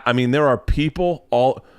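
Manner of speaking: normal talking voice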